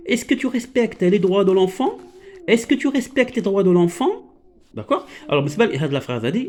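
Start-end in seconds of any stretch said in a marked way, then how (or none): 0.1-2.0 rising question tune on "Est-ce que tu respectes les droits de l'enfant?"; questioning intonation. Shown as a demonstration. Teacher to pupils, modelling a rising question tune. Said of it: "اسمعوا هنايا". 2.5-4.2 rising question tune on "Est-ce que tu respectes les droits de l'enfant?"; questioning intonation. Shown as a demonstration. Teacher to pupils, modelling a rising question tune. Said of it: "لاحظوا معايا حتى في l'intonation".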